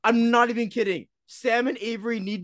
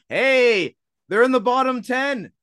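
ordinary talk, nothing marked

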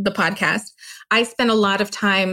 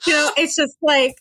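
ordinary delivery

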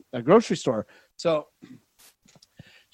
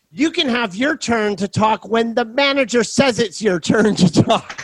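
higher, prissy voice, slow at the end; mocking the snooty lady; 8 semitones higher than usual. The lady dismissing the shopper, snooty mimicry. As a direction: look down your nose and drag out turn to talk. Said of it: "she goes".

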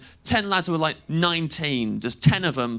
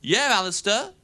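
mock telling-off; 6 semitones higher than usual. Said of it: "I went".

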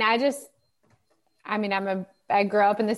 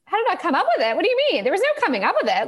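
incredulous reply; 10 semitones higher, 3 dB louder, much faster than usual. Retold or imitated. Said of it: "I'm like".

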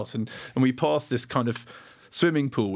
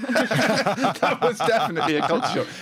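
higher, laughing through the words; laughing repeat; 6 semitones higher, 3 dB louder than usual. Real, not acted. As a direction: say it again, cracking up.

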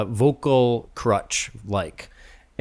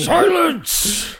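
mock shout. Mock command to guest.

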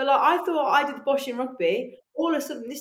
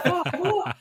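laughing disbelief; 8 semitones higher, 4 dB louder than usual. Retold or imitated. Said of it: "I was like".

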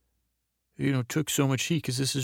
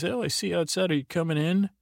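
higher, breezy fake-innocent invitation; mock innocent Walt; 6 semitones higher, faster than usual. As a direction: play dumb, lightly sing-song. Walt plays innocent, acted quote.

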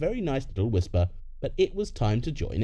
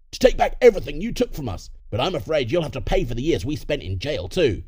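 raised, forceful villain voice; threatening parent; 3 semitones higher, 4 dB louder than usual. Acted. Criminal parent demanding payback from the son, threatening. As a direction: open loud and high, then settle into the threat.

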